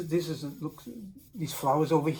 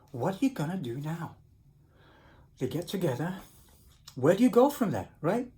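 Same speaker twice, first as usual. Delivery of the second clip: ordinary delivery, nothing marked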